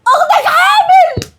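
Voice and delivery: high, slow shout; shouted news. Acted. Mother telling her son the sister is pregnant, loud slow shout. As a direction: blurt it out in a long high shout.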